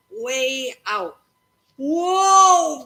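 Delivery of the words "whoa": high, loud amazed whoa; yell. Acted. Boy shouting as his ball flies far. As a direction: belt it out in awe. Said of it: "Ben yelled".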